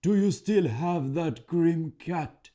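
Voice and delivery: high, loud, slow hollering troll; a troll hollering across the forest; calling troll. A narrator's reading. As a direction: call out warily from the trees. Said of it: "the voice called".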